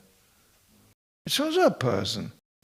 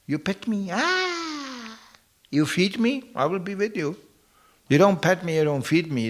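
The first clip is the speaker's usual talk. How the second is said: mock cat, higher and fickle; opportunist cat voice; 6 semitones higher than usual. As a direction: play a spoiled cat asking for treats. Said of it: "They just go".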